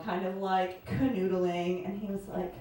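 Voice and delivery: usual speaking voice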